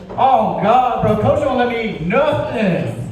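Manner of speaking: put-on high, slow whining kid voice; whiny kid. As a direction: complain like a hungry middle schooler. Kid complaining in story.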